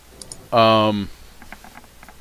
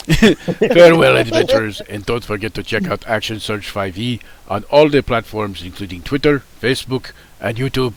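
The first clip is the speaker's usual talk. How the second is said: put-on louder, grand Russian-accented sign-off; 3 semitones higher, 7 dB louder than usual. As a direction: boom out a hammy Russian farewell. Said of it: "I'm doing terrible at this Russian accent".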